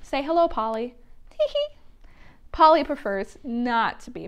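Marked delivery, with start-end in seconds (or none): 1.3-1.8 very high puppet giggle; cheeky giggle. Acted.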